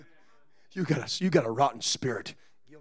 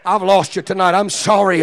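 ordinary talk

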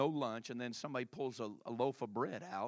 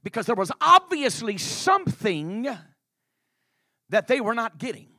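full-force preaching; 7 semitones higher, 16 dB louder than usual. Acted.

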